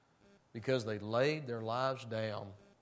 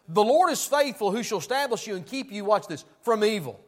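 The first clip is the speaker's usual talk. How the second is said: loud, high-pitched pulpit preaching; fiery preaching; 6 semitones higher, 11 dB louder than usual. Acted. Preacher to his congregation, fiery full-force preaching.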